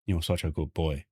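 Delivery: soft, low coo to a pet; tender to the cat. Real, not acted. Talking softly to the cat, tender coo. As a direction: praise the cat gently.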